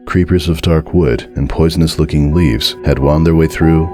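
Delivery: usual speaking voice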